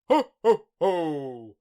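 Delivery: a big jolly Santa belly laugh; Father Christmas jolly laugh. Acted. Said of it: "rumbled a jolly voice".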